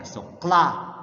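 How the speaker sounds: teacher demo of kla; cluster sound demo. Shown as a demonstration. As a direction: isolate kla for the class. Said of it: "magkasama ang K L".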